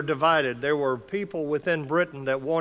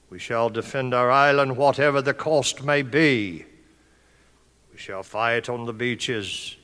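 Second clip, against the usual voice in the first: put-on rally the nation in a British accent, surges louder on the fight lines; Churchill British accent; 5 dB louder than usual. Speaker recreates Churchill's speech for officers in a British accent. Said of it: "my best British accent".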